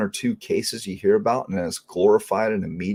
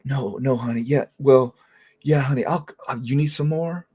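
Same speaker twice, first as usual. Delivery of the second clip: higher, syrupy mock sweetness; lightly voiced nurse, sugary and higher; sweet-talking nurse; 4 semitones higher than usual. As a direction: imitate a sugary nurse cooing. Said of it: "she said".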